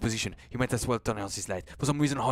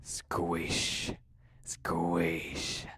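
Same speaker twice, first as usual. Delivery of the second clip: slow wet squish, drawn out; squelching sound; 6 semitones lower than usual. A narrator's reading. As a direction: make the mud squelch. Sound effect words.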